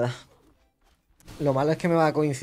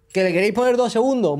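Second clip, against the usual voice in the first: high, louder indignant question; outraged; 7 semitones higher, 3 dB louder than usual. Real, not acted. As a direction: fire it back in disbelief. Outraged at the stun change.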